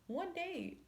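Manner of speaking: high, scoffing repeat of one date; incredulous. Real, not acted. Scoffing at the dude over one date, incredulous high repeat. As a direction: scoff at how little it was.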